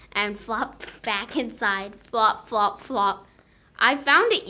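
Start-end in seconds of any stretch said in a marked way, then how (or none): 3.8-4.5 yelling. A narrator's reading. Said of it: "yelled Froggy".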